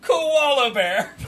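high, drawn-out Aussie mimicry; mock Australian accent. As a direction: stretch it out in a laughing Australian voice.

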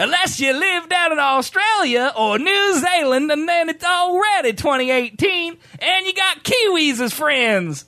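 high, drawn-out announcer voice; fighting game announcer. Pretend. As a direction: boom it out like a ring announcer. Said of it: "I'm gonna talk in my announcer voice the entire show".

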